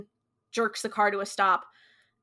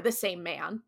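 ordinary delivery

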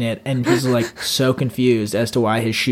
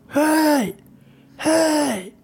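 high, strained mock yelling; imitating yelling; much slower than usual. Shoeless man yelling at a passer-by, imitated yell. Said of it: "heard the guy yelling about the shoes".

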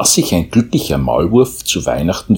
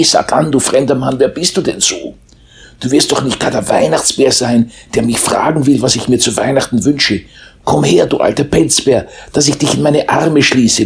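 cheerful mole; 8 semitones higher than usual. A narrator's reading. Mole greets the Christmas bear.

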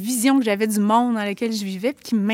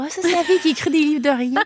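higher, louder, quick teasing imitation of people labelling her; playful mimic of gossip, pitched up; mock gossip voice; 6 semitones higher, 4 dB louder, faster than usual. Author mimics readers who label her, in a quick higher teasing voice. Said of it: "Là, je vais être collée avec ça".

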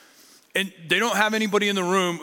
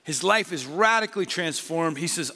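ordinary talk

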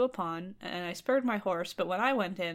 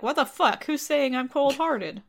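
loud, higher indignant retort; indignant outburst; 5 semitones higher, 5 dB louder than usual. Acted. King shouting back at the voice in the fire, indignant. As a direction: demand it, offended and raised.

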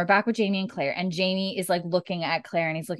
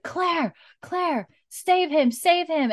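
high, pleading cry of a name; imitating Jamie begging Claire; desperate plea; 11 semitones higher, 3 dB louder, slower than usual. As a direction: beg her to save him, voice up high.

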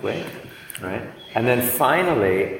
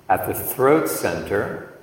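ordinary talk, nothing marked

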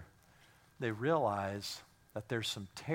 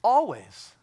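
much higher and louder, ringing out; shouted repeat; 11 semitones higher, 11 dB louder, slower than usual. Acted. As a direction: shout it for the ones who missed it. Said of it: "I'll say it again".

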